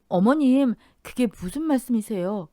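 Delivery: high, startled question. Acted. Daughter-in-law shocked by her mother-in-law's words, startled. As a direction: blurt it out in shock. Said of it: "너무 놀라".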